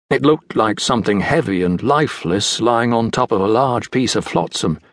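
ordinary delivery

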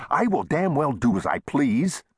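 high-pitched indignant retort; heated. A narrator's reading. Shopkeeper defying the saloon owner, heated. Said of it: "Chester said heatedly".

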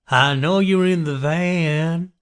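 high, slow sing-out to hidden victims; delighted killer. A narrator's reading. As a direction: taunt them with drawn-out delight. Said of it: "He was now delighted that he had victims".

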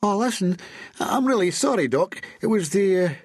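high-pitched, stammering excuses; flustered apology. A narrator's reading. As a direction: plead your case high and hesitant. Flustered Andy apologises to his boss.

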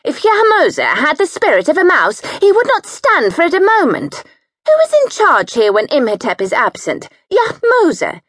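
bossy sister-in-law ranting over cushions; high domineering wife. A narrator's reading. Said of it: "high, domineering and bullying".

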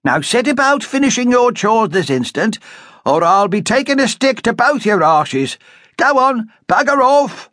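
high, loud bossy command; sharp order. A narrator's reading. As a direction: send them off to their chores.

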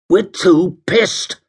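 high, slow, savage retort. A narrator's reading. Jim refuses to help Alicia, savage. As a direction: snap it savagely, drawing the words out. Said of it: "said Jim savagely".

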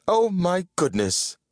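high-pitched dismayed exclamation; exclaiming. A narrator's reading. Winifred seeing the racing form, high exclamation. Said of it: "exclaimed Winifred".

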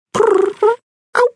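high rolling prrr-ow cat call. Acted. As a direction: trill it like a curious cat. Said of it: "he called".

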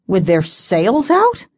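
slow, doubtful question; dubious. Acted. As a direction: let the doubt show, stretch it out. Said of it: "his tone dubious".

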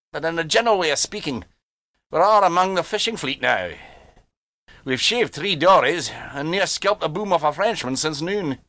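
put-on dry old seaman; wry engineer; Scottish engineer. A narrator's reading. Engineer voiced.

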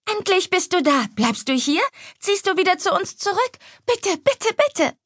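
put-on very high, eager little-girl voice; excited pleading child. Little sister begging big sister to move back, excited pleading.

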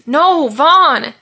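high, loud anguished cry; drawn, grief-struck shout; desperate yell. A narrator's reading. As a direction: cry out in denial for your friend. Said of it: "yelled Malachi as he fell to his knees".

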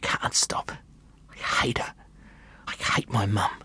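put-on a whispered child's despair. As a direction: breathe it through tears. Boy crying, whispering.

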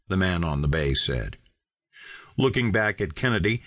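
plain reading voice

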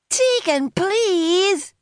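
high, drawn-out plea; pleading. A narrator's reading. Teen begs Tegan, high-pitched pleading. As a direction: beg in a high teenage whine. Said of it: "Ali begged".

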